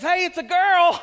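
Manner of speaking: bright, loud doctor's announcement; excited announcement. Retold or imitated. Doctor announcing the baby to the new father, excited. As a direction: proclaim it's a girl.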